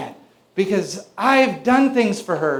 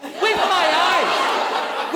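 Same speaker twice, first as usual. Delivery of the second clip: slow punchline; 7 semitones higher, much slower than usual. Real, not acted. Comic repeated punchline.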